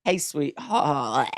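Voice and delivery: slow, strained, can barely get the word out; forced cringey line. Shown as a demonstration. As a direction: drag out each word as if it sticks in your throat. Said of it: "The heart doesn't come out".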